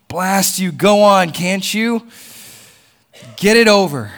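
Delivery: loud, high, desperate outburst; exasperated shout. Acted. Man yells at the angel to kill the lizard, exasperated shout. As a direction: shout it out to get it done.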